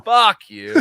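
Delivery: loud, high joking comeback; mock outrage. Real, not acted. Mock outrage at friend's teasing.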